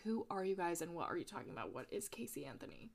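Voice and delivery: fast, quiet, fading to a whisper; baffled mumble. Retold or imitated. Confused woman questioned by police, whispered. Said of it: "She was like".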